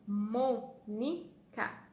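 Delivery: "Mônica" very slowly as MO, NI, CA; syllable by syllable. Shown as a demonstration. Teacher asking which syllable is stronger, said syllable by syllable. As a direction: break "Mônica" into three drawn-out syllables. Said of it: "Qual que fala mais forte?".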